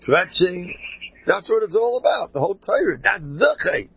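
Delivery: high, loud, urgent preaching; fiery preaching. Acted. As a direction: preach it hard, almost scolding. Impassioned preaching rant.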